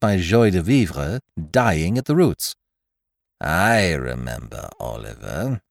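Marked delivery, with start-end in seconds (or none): 3.4-5.6 put-on much lower, slow old woman; slow, heavy elderly recollection; old lady. A narrator's reading. Ancient mother slowly recalling the guest as a boy. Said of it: "said Exhibit A".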